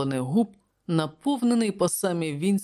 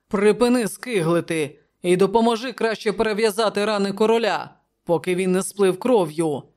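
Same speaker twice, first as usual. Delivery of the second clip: loud, raised, impatient command; angry order; 4 semitones higher, 5 dB louder than usual. A narrator's reading. Commander telling the counsellor to help the king, angry. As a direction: order him sharply to stop fussing. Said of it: "сердито пирхнув Палантіт".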